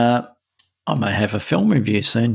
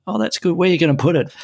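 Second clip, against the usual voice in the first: lightly voiced wife, pitched higher; imitating wife's reaction; 7 semitones higher, much faster than usual. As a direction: quote the wife in a higher, offhand voice. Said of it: "her first comment was".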